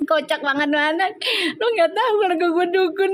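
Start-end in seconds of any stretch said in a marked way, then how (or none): 1.6-3.1 higher, laughing retelling of the line; laughing through the quote. Retold or imitated. Mother cracks up repeating her daughter's retort, laughing. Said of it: "Gue ngakak kayak".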